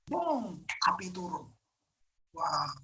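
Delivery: ordinary talk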